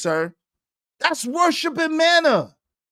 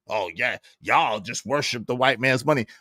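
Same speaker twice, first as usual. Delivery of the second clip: deep, quieter sneering voice; low and dismissive, mocking J P; 13 semitones lower, 5 dB quieter than usual. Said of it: "it's like J P".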